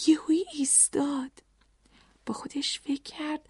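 whispered, suspenseful narration; hushed. A narrator's reading. Narrator telling children he suddenly stopped, hushed whisper. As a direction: sink into a very soft whisper.

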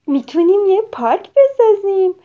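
high, loud eager voice; excited child. Acted. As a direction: bubble over with ideas. Excited child planning play.

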